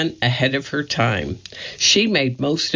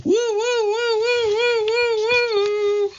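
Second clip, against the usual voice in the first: much higher, goofy woo woo hoots; hooting woo woo. Acted. Curly running down the hospital hall hooting much higher. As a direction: hoot like a silly clown running off. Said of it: "he ran down the hall hooting".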